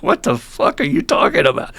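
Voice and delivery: retold stunned comeback; incredulous. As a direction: throw it back in mock disbelief. Newcomer's stunned retort retold.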